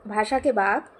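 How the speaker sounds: ordinary talk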